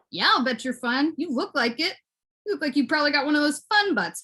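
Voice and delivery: normal talking voice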